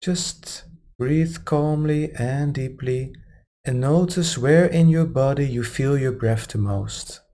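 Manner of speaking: calm, unhurried meditation guide; guided meditation. Acted. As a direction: ease them gently into relaxation. Meditation guidance resumes after aside.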